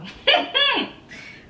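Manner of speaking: a sudden high laugh to cover tears; high tearful laugh. Real, not acted. Breaking the emotional moment for viewers, tearful laugh.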